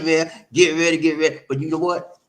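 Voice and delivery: ordinary talk, nothing marked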